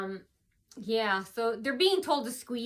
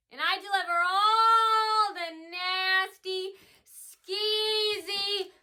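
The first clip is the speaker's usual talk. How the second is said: high, drawn-out proclamation; theatrical persona announcement; 7 semitones higher, 6 dB louder, much slower than usual. Acted. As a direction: stretch each adjective out grandly, almost chanting. Declaims a persona's introduction.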